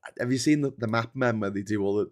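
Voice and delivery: ordinary delivery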